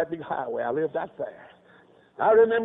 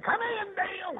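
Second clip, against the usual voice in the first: high-pitched call through a door; 13 semitones higher, 4 dB quieter than usual. Retold or imitated. Father calling his youngest boy into the office, calling out. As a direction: sing out high, without much force.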